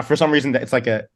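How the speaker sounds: normal talking voice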